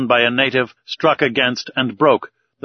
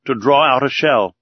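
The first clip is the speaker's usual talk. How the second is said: ordinary delivery, nothing marked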